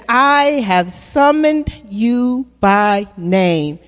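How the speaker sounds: slow, weighty scripture reading. Shown as a demonstration. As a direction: read it slowly so they can follow. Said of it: "Say that with me".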